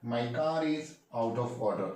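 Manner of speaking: slow, even teacher repeat of the sentence; slow clear repeat. Shown as a demonstration. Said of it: "क्या बोलेंगे guys?".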